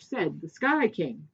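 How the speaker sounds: ordinary delivery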